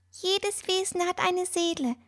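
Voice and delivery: high, soft squeaky whisper. A narrator's reading. Bird explains souls to Fine, in a high soft whisper. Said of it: "flüsterte Mister Thompson".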